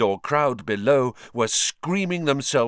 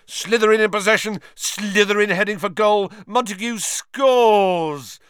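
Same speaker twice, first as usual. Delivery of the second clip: excited commentator; 8 semitones higher, 5 dB louder than usual. Acted. Commentator calls the play.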